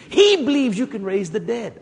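usual speaking voice

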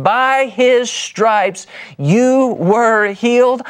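lifted high and drawn out, each word weighed; high slow scripture. Acted. Preacher to congregation, quoting Jesus, high and slow. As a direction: proclaim it like a promise from on high.

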